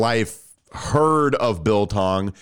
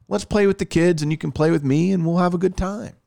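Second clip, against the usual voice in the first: a lightly voiced, higher sing-song plea; playful quoted voice; 7 semitones higher than usual. Host quoting someone's pitch to Tom, higher playful voice. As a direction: coax like a playful spouse.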